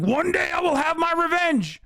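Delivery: high, shouted, hammed-up villain voice; over-the-top cartoon villain yelling a vow; anime villain vow. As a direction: play the wronged anime villain swearing revenge. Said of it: "He's like".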